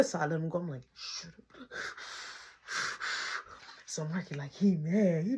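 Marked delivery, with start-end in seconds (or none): 0.9-1.7 voiceless hissed whisper; very soft, urgent hush; retold hush. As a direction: shush him in a whisper. Hushing boy during prank.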